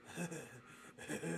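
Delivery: soft, breathy chuckle in character; quiet stuttering creature laugh; Kenku laugh. Acted. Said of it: "he goes".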